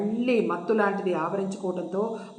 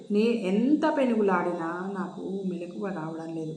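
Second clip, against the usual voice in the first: faint, softer, drained of strength; weakly; 3 semitones lower, 7 dB quieter than usual. A narrator's reading. Jayanthi too weak to wake, speaking faintly. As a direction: say it weakly, worn out. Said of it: "నీరసంగా అంది జయంతి".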